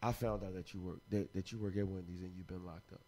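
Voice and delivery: very low, hushed confiding voice; understanding woman. Acted. A good woman confronting her man, hushed and understanding. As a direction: speak softly and gently, barely above a murmur. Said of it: "A real woman would be like".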